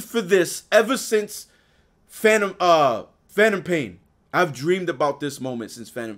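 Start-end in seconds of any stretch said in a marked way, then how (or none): none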